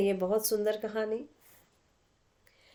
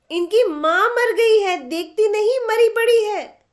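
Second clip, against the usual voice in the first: put-on high, loud boy lecturing his sister; know-it-all boy; 12 semitones higher, 9 dB louder than usual. A narrator's reading. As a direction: show off like a bossy big brother. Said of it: "अपने बड़प्पन और ज्ञान की छाप लगाई". (बहन को ऊँची, तेज़ आवाज़ में समझाता लड़का)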